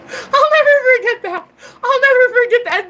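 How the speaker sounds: high, loud squeal through laughter; cracking up. Real, not acted. Recalling the man sent off shoveling, cracking up. Cracking up at her own story.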